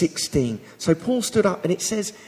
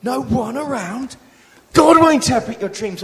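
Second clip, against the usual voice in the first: higher and louder, bursting out; exploding with zeal; 8 semitones higher, 9 dB louder than usual. Retold or imitated. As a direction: explode with excited conviction. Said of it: "He explodes".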